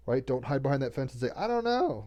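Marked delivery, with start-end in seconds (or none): 1.4-2.0 very high-pitched helpless imitation; mock helplessness. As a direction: mimic someone timidly giving up. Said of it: "lack mentality".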